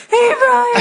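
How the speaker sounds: high, loud mock drag greeting; hammed-up falsetto drag voice. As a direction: put on a big high drag-queen hello. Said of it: "imagining you and Drag like".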